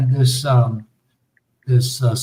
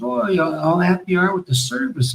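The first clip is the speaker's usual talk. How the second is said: lightly voiced higher chirpy survey lady; imitating the lady caller; 6 semitones higher, 4 dB quieter than usual. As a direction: mimic a cheery phone survey caller, pitched up. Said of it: "She goes".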